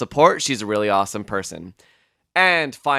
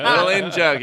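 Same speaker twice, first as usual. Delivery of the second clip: laughing; 7 semitones higher than usual. Real, not acted. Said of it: "listen to the bloopers for that one".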